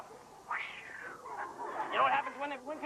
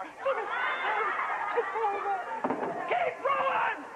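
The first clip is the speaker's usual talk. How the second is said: laughing shout; 8 semitones higher, much slower than usual. Acted.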